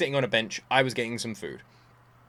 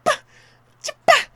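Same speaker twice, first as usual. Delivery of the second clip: flustered sputter; 5 dB louder than usual. Retold or imitated. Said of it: "And I'm like".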